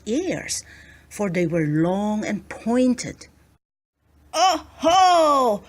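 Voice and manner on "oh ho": knowing oh-ho. A narrator's reading. Farmer spots an elf.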